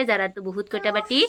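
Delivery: ordinary talk, nothing marked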